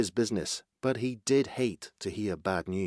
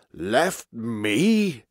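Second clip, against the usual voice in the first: put-on stunned old-boss voice; astonished; 3 dB louder than usual. A narrator's reading. Old walrus chief learning he is deserted, astonished. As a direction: question it in disbelief. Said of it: "The old walrus was so astonished".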